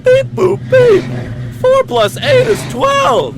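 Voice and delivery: very high, slow, loud robotic beeping and announcing; robot blaring its answer. Acted. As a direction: beep like a calculator machine, then shout the sum. Robot beeps then calculates.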